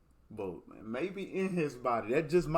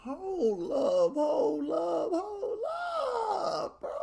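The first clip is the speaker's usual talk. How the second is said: mock anguished wail; 13 semitones higher, much slower than usual. Real, not acted. Streamer stunned by Bird's game winner, crying-out mock wail. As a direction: wail it like your heart just broke.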